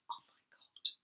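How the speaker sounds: breathy awed whisper. Real, not acted. Whispering over a boyfriend's peanut butter cake. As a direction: hush it like a guilty secret.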